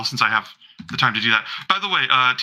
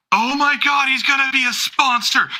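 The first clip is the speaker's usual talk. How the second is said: much higher, thrilled squeal of news; overexcited fan; 11 semitones higher than usual. Acted. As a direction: squeal the news like it's huge.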